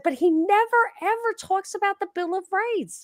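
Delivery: high-pitched retold objection; emphatic protest. Arguing with a military guy about Trump, emphatic protest. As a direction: protest with the voice pushed right up.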